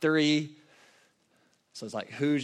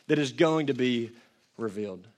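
ordinary delivery

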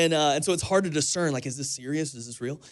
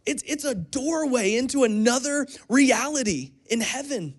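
raised, high preaching voice; 6 semitones higher than usual. Acted. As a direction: preach it with rising conviction. Preaching on eternal reward.

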